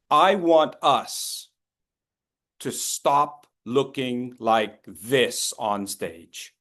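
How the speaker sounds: slow emphasis. Real, not acted. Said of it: "speaker slows to stress the point".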